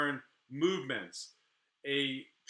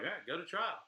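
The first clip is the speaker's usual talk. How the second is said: mocking dig; 4 semitones higher, 6 dB quieter, faster than usual. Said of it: "throw a little dig in there".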